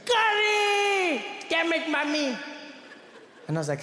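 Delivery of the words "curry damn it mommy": high, long-drawn scream of outrage; top-of-lungs scream. Retold or imitated. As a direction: scream the first word high and drag it out, then curse. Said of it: "He screams like".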